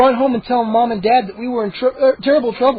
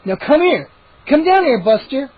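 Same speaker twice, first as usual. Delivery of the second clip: calling a dog; 6 semitones higher, 3 dB louder than usual. A narrator's reading.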